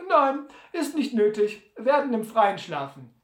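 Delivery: high, loud, breezy character voice; high-voiced Sam. Sam declines lodging to Butler, high and offhand. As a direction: wave the question off in a high voice.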